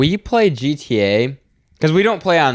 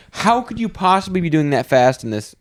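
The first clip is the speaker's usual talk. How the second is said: high-pitched mock outrage at a driver; freaking-out cop; 7 semitones higher than usual. Cop berating a driver at a traffic stop, freaking out. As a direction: play a cop scolding in disbelief. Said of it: "he's freaking out".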